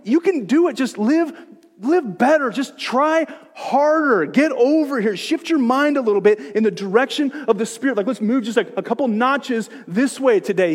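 hammed-up motivational speaker; mock pep talk. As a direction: coax them along like a pushy coach. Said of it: "He's not saying".

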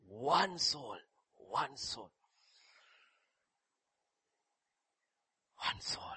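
fading to whisper. Acted. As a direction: let it die away to breath. Repeated phrase fading away.

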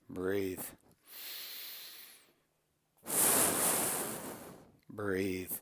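low, soft, spaced-out breathe cues; whisper-close cues with long pauses between; guided breathing. Shown as a demonstration. Coach guiding viewers to breathe, low and soft.

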